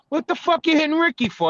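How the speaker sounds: high-pitched, incredulous demand; incredulous friend. Retold or imitated. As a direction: act out a friend's shocked protest. Friend's outraged question, acted.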